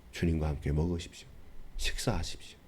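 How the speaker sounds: hushed call to dine with the Lord; soft invitation. Acted.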